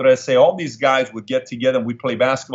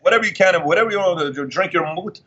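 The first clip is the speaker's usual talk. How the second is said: mock commercial voice; 6 semitones higher than usual. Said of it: "a commercial over and over".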